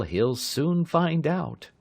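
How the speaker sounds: slow, heavy and grim. A narrator's reading. Police driver warns of the man's fate, slow and grim. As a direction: let each word land slowly and darkly. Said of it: "the police driver said grimly".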